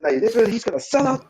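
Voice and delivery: high, psyched-up agent pitching his act; super psyched. Acted. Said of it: "he is super psyched".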